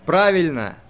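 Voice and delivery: loud call of approval; shouted from the crowd. A narrator's reading. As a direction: yell it out from the crowd. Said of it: "выкрикнули из толпы".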